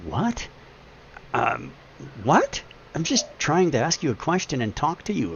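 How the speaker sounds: mock bewildered, voice jumping high on "what"; baffled disbelief. Customer answering a clerk who called the question hostile, baffled disbelief. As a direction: act out a high-pitched, taken-aback protest.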